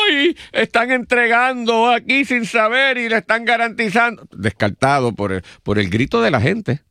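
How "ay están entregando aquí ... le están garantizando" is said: high-pitched mock cry of protest; imitating people crying out, pitched way up; imitated public outcry. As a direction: wail it like an alarmed crowd, without extra volume. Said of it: "entonces gritaba la gente".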